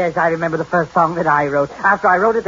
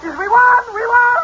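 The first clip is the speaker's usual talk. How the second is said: high, excited crowing; overjoyed and squealing; jubilant; 4 dB louder than usual. Acted. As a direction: shout the win in a high voice. Repeats winning news, thrilled.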